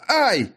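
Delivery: high, sudden "ouch"; pained yelp. Acted. Wizard as his palm is cut, pained yelp.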